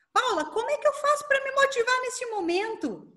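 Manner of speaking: lifted, questioning audience voice; imagined listener's question. Acted. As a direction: pipe up high with an audience member's question.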